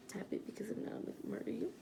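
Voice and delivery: unvoiced mock threat; whispered threat. Playful threat.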